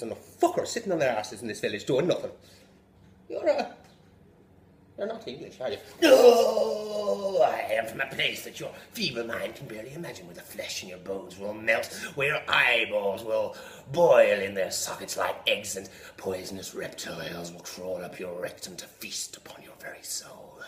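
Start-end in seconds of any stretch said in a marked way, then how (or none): 3.3-5.8 higher, wary local; suspicious villager. Acted. Villager checking if the devil is English, wary. 6.0-7.4 huge shouted demonic no; devil's roar. Acted. Devil roaring at the villager, furious. 7.4-15.8 menacing devil. Acted. Devil threatening the villager, menacing. 15.8-20.6 sinister whispered threat; devil's whisper. Acted. Devil whispering horrors to the villager.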